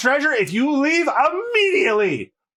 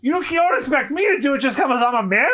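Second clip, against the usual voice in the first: ordinary talk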